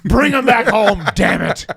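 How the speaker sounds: high, loud mock boss bellow; imitating the boss. Wrestler imitates the boss ordering them back, loud mock bellow. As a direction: ham up the boss barking orders. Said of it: "He said".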